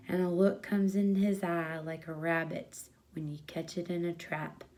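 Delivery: ordinary delivery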